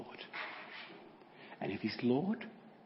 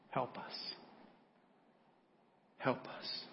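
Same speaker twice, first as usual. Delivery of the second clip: whispered plea; 7 dB quieter, much slower than usual. Acted. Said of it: "crying out".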